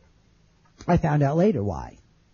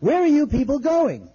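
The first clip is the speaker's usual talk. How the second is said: high-pitched calling out, only slightly louder; retold yell; 11 semitones higher, 3 dB louder than usual. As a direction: imitate a stranger yelling from a doorway. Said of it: "some guy yells out".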